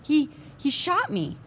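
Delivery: stunned, slow and high; shocked. Acted. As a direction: gasp it out in shock. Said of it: "in a shocked voice".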